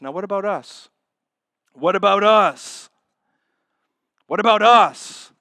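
rising challenge to the congregation; building question. Shown as a demonstration. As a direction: press the church harder each time. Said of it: "repeated question building".

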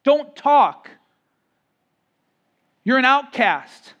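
loud, high scolding; stern rebuke. Acted. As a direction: shut the beggar up. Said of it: "they spoke sternly to him".

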